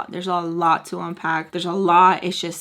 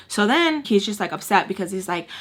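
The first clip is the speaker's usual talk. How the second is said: normal talking voice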